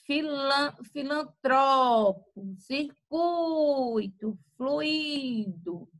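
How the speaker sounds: filantropo, circuito, fluido with TRO, CUI, FLUI stretched very long; slow vendor-like call, holding the stressed syllable in filantropo, circuito, fluido; penúltima sílaba forte. Shown as a demonstration. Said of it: "a penúltima vai ter o som mais forte". (filantropo, circuito, fluido com a tônica bem alongada)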